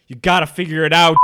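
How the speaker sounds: loud, fed-up snap; exasperated coworker calling back. Retold or imitated. Coworker yelling back from the sandwich station, exasperated. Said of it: "just so exasperated".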